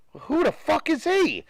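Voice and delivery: mock outrage at an unknown coach; incredulous outburst. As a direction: imitate a baffled fan who never heard the name. Acted fan reacting to hire.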